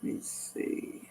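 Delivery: breathy whisper while searching; quiet aside while looking something up; whispered to herself. Real, not acted. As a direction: whisper it under your breath, distracted.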